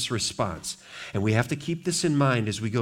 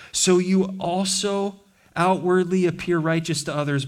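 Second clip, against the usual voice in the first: raised, forceful preaching of woes; 4 semitones higher than usual. Acted. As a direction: thunder the woe over the crowd.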